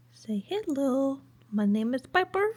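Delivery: high, slow silly voice for the dog; weird pet voice. Pretend. Owner voicing her dog to viewers, silly high voice. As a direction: speak for the dog in a goofy voice. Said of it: "I'm doing that weird accent".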